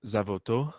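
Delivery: name said correctly, open "vo"; "Zavoto" with an open "vo". Shown as a demonstration. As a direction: say "Zavoto" stressing "vo", closing on "to".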